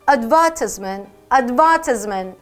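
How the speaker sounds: slow British "advertisement", ad-VER-tis-ment; British pronunciation. Shown as a demonstration. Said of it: "이건 영국이에요". ("advertisement" 영국식 '어드버티스먼트', 천천히)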